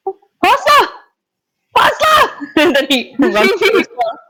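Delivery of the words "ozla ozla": high-pitched cry of the name; calling out. Acted. Companion calling after Ozla as she rushes away, calling out. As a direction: call urgently after someone running off.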